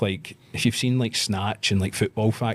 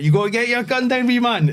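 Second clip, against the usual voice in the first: loud warning; 7 dB louder than usual. Retold or imitated. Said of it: "and I went".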